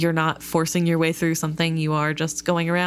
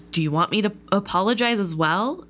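ordinary talk, nothing marked

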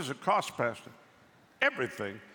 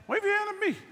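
calling out; 5 dB louder, faster than usual. Real, not acted. Calling out to congregation.

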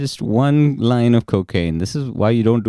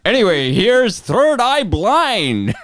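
higher, slow DJ song intro; radio DJ voice; 10 semitones higher, slower than usual. Pretend. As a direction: announce the next track like a radio host.

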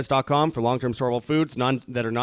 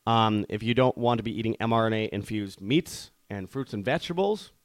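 ordinary delivery

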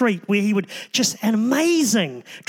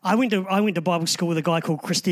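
ordinary talk, nothing marked

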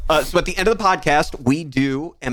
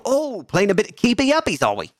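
put-on lilting Aussie accent, pitched high; Australian accent; 5 semitones higher than usual. Dad teasing his boys about a balloon game, Australian accent. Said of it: "That's all my Australian I got".

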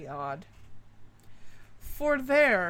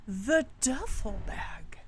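slow, hushed whisper; spooky whisper; 2 semitones lower, 3 dB quieter, slower than usual. Acted. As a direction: hush it like a campfire secret. Spooky story reveal whisper.

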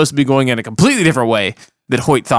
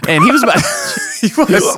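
laughing; 6 semitones higher than usual. Real, not acted. Laughing while telling it.

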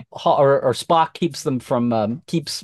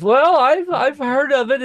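higher, flustered defensive protest; 10 semitones higher than usual. Retold or imitated. Kid defending himself to the teacher, high and flustered. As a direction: squeak out a flustered excuse.

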